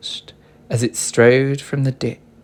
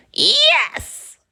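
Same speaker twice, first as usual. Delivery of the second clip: very high whispered witch cry; a high breathy yes; witch cries yes; 5 dB louder than usual. A narrator's reading. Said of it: "cried the witch".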